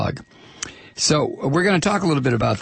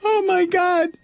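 exasperated disbelief. Real, not acted. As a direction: react to a sudden mishap on air. Dismayed at on-air technical trouble.

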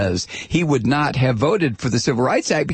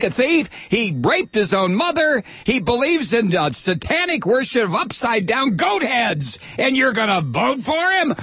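hammed-up attack ad; 8 semitones higher than usual. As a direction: ham up a fearmongering political ad. Parodying attack ad.

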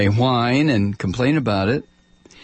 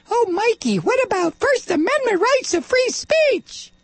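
much higher, nasal-free mock whine, nagging; mocking high whiny critic. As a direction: mimic a prissy critic objecting.

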